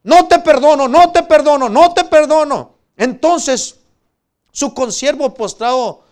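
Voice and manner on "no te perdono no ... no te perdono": grito alto y agudo, repetido con fuerza; exigencia furiosa. Acted. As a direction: reclama la deuda a gritos, como acreedor implacable. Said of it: "le ahogaba diciendo". (grito furioso dramatizado)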